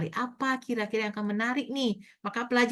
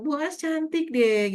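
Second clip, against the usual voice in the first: put-on slow, higher childlike sweet talk; imitating a small child; 6 semitones higher, slower than usual. Child praising teacher Bu As, drawn-out childlike voice. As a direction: draw it out like a flattering toddler. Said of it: "Dia manggil saya kan Bu As".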